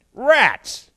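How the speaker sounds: high, loud cry of mock frustration; mock dismay. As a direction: blurt it out like you've just been caught. Mock dismay at being on list.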